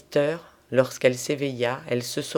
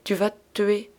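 higher-pitched, alarmed warning; frightened warning; 7 semitones higher than usual. A narrator's reading. Satin warning a friend at the window, frightened. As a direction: warn with a touch of fear. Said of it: "répétait Satin effrayé".

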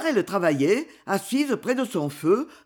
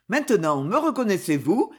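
ordinary delivery

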